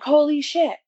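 high, loud shocked exclamation. Retold or imitated. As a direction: blurt it out, caught completely off guard. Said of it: "I'm like".

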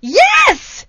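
real high, loud shout; emphatic outrage. Real, not acted. Storyteller confirming to shocked listener, emphatic shout. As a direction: burst out in indignant confirmation. Exclaiming answer to listener's question.

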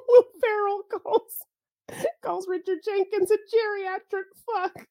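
high and laughing, slowed down. Real, not acted. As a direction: giggle your way through it. Laughing through a movie line.

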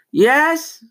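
high, loud call back to another room. Real, not acted. Teen answers mom calling him to eat, loud high call. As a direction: answer mom from across the house.

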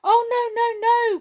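high, loud panicked wail; very frightened cry. A narrator's reading. Brownies facing the king's threat, very frightened. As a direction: cry out in terror, pitched right up. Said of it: "very frightened indeed".

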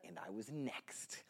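hushed whisper, slow. Real, not acted. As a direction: whisper it like a secret. Tense wait before his turn.

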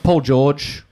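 usual speaking voice

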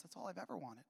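very soft retold answer; quiet confession. Mother admitting her one wish to son, quietly. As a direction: answer simply, in a hushed voice.

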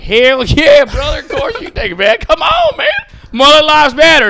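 imitated hyped-up yelling; rowdy mock enthusiasm. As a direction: whoop it up like an eager fan. Acts out eager shirt wearers shouting.

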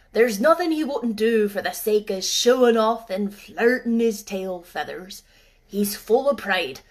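put-on an old man scoffing at vanity; snapping old gardener. Ben scoffing at the robin to Mary, gruff snap. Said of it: "snapped Ben Weatherstaff".

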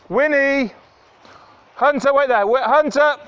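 loud, high call across the field; calling the dogs. Real, not acted. Owner calling her dogs back on a walk, loud high call. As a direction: shout them back to heel.

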